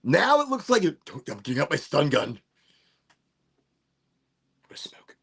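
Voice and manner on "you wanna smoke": breathy hushed offer; whisper. Pretend. Streamer whispers an offer to smoke, hushed.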